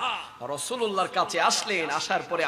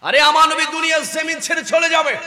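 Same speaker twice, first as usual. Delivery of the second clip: high, loud outcry; impassioned announcement; 9 semitones higher, 7 dB louder, faster than usual. A narrator's reading. Preacher announces the Prophet will leave the world, impassioned. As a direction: cry out the news at full force.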